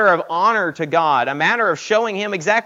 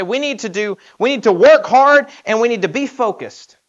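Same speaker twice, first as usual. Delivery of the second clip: louder, pressing on "work" and "focused"; "We need to work hard" punched louder with stress on "work"; emphatic demo; 5 semitones higher, 8 dB louder than usual. Shown as a demonstration. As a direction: drive the point home like a pep talk. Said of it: "Trying to emphasize the point".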